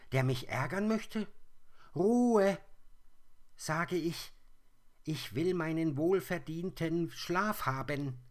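hoher, lauter, grantiger Igel; übellauniger Igel. Acted. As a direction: schimpf wie ein aus dem Winterschlaf gerissener Igel. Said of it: "Sie klang sehr übellaunig". (der geweckte Igel schimpft hoch und laut)

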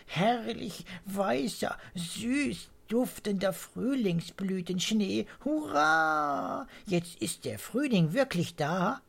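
high, slow rapturous bird voice; joyful swallow. A narrator's reading. Mr Swallow celebrates the blossom snow, high joyful voice. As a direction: savour every word with joy.

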